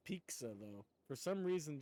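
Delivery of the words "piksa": "pizza" said as PEEK-suh; K-S in the middle of "pizza"; imitated pronunciation. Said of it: "Iron Mouse says pizza".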